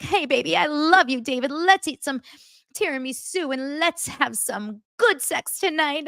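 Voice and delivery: high-pitched lovestruck gushing; hammed-up giddy girlfriend, voice way up; happy Christine. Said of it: "it's, like, such, like, happy sad".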